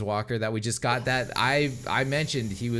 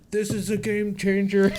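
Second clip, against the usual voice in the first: excited; 11 semitones higher, 5 dB louder than usual. Retold or imitated. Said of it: "I was like".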